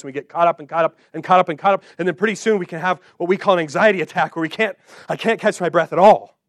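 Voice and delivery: speeding up and climbing into a breathless rush; anxious rush building to a gasp; rising panic. Pretend. Describing a panic attack building up.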